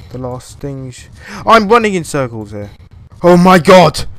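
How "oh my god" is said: loud frightened yell; startled scream. Real, not acted. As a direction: shriek at the scare. Jump scare.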